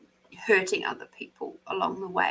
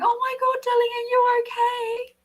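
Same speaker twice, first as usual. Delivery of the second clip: shrill-high panicked mum voice; fussing helicopter mum; 13 semitones higher than usual. Retold or imitated. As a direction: play the overprotective mother rushing onto the track. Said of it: "running out onto the, the aerodrome and going".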